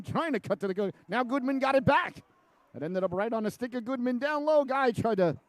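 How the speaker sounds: sudden excited surge in the call. Real, not acted. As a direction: lift the voice as the chance builds. Shot chance in live call.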